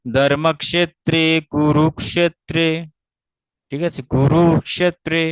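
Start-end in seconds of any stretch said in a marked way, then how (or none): 0.1-2.7 syllable by syllable, slowly; chanting ধর্মক্ষেত্রে কুরুক্ষেত্রে slowly; slow recitation. Shown as a demonstration. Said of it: "তো সংযোগকে কি কুরু". 4.1-5.2 কুরুক্ষেত্রে drawn out with the heavy syllables stressed; slow, weighted কুরুক্ষেত্রে. Shown as a demonstration. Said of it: "ক্ষেত্রে হচ্ছে সপ্তম বর্ণ, গুরু".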